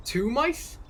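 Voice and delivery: high, loud rising surprise; surprised question. Acted. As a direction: exclaim it in surprise, pitch leaping up. Startled at seeing pet mice.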